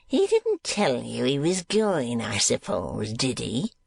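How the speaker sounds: slow sneer dropping off at the end; slow contemptuous sneer. A narrator's reading. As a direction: taunt him with mock doubt. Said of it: "sneered Squeers".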